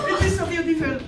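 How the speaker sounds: lightly hammed Italian accent; a touch of mock Italian accent. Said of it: "I'm doing my Italian accent".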